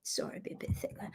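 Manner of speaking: breathy whisper, half to herself; whispered apology. Real, not acted. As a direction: whisper it, a bit embarrassed. Embarrassed aside after realizing.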